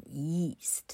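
yeast with a clear y before the long ee; slow, careful yeast for learners; model pronunciation. Shown as a demonstration. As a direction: demonstrate the y glide in yeast. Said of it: "[name]念给你听哦".

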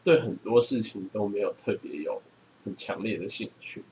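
ordinary talk

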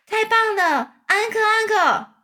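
high, loud cheering spider voice; excited shout. A narrator's reading. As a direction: call out for an encore at a high pitch. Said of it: "蜘蛛小姐还站起来大喊". (兴奋地高声大喊)

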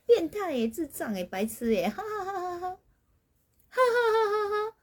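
high playful insults, then a long slow laugh; family teasing laugh. Retold or imitated. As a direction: toss out silly insults and cackle. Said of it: "我们家的惯性就是如此".

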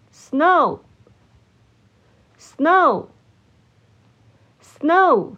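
snow said slowly, long pause after each; repeat-after-me drill. Shown as a demonstration. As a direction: model the word snow for learners to echo. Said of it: "请大家跟我一起念三次". (跟读示范，一词一顿)

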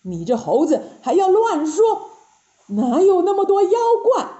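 indignant monk's rebuke, heavily weighted words; acted righteous anger; stern banishment. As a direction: scold and disown your disciple. Master angrily banishes disciple.

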